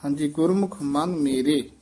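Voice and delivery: ਗੁਰਮੁਖ ਮਨ ਮੇਰੇ with a clear stop after ਮੇਰੇ; ਮੇਰੇ ਤੇ ਵਿਸਰਾਮ; pause after ਮੇਰੇ. Shown as a demonstration. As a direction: rest the voice on ਮੇਰੇ before going on. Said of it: "ਇੱਥੇ ਵਿਸਰਾਮ।".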